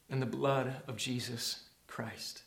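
low, soft, fading to a whisper; hushed reverence. Real, not acted. Preacher on the value of Christ's blood, hushed awe. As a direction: drop the voice in awe. Solemn emphasis to congregation.

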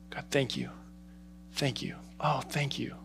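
whispered, hushed thanks to God; whispered worship. Shown as a demonstration. Pastor showing what heaven's worship sounds like, whispered. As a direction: breathe out thanks barely voiced.

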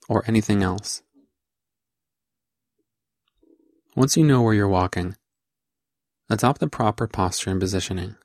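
measured guide voice with long pauses between phrases; deliberate, spaced-out meditation script read; guided meditation instruction. Acted. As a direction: guide them step by step, leaving space after each phrase. Leads a walking meditation.

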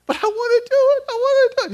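hammed-up kid pleading; whiny child. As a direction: mimic the son begging hard. Son voiced insisting.

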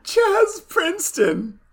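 high incredulous. Real, not acted. Savoring the absurd name.

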